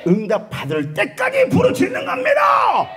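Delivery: slow, high, loud preaching cry; preaching shout. Real, not acted. Preacher urging the congregation to cry out, shouting. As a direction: proclaim it at full force.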